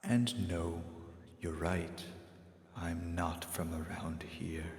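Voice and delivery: soft, slow, half-whispered stranger; quiet, slow, breathy-free whispered calm; mysterious hooded stranger. Acted. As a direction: hush it like a figure in the dark. Stranger gives his name.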